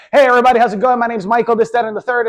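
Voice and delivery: loud, high, fast hyped-up hello; man rushing into a networking meeting, loud and overexcited; overeager rushed greeting. Shown as a demonstration. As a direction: barge in way too loud and eager. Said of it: "start talking really fast".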